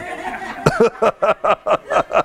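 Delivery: real laughter rising high then falling; high burst of laughter. Real, not acted. Laughing at movie memory.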